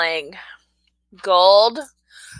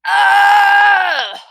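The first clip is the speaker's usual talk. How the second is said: loud, high burst of a yell; excited yell; 10 semitones higher, 8 dB louder than usual. Real, not acted. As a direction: cry out at the roll. Dice roll reaction.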